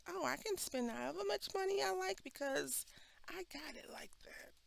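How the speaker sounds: high, airy show-off voice sinking to a whisper; mock big spender. Talking about a careless spender, a high mock brag. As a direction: brag like money is no object.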